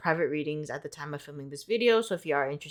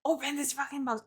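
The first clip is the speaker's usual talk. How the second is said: high, softer mock-urgent squeal; mock impatience; 9 semitones higher, 6 dB quieter than usual. Vlogger rushing before going out, mock impatience. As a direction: squeeze it out high in a playful hurry. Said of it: "so I'm like".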